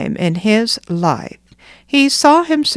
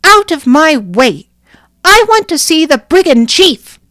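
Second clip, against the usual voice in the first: put-on high, loud, bossy child's demand; a small girl who will not be stopped; little girl crying out; 8 semitones higher, 7 dB louder than usual. Nanina crying to the crowd, high and loud child's demand. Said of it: "as she cried".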